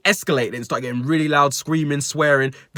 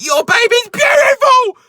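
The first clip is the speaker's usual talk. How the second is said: much higher and louder, slow, all-out scream; angry scream; 9 dB louder, slower than usual. Retold or imitated. Shoplifter screams at the dad in the store, furious scream. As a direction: scream the compliment in rage. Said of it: "in such an angry tone".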